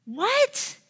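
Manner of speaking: high, sharp disbelief; a pastor's wife, amazed at herself; incredulous. Real, not acted. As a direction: squeak it out in shock.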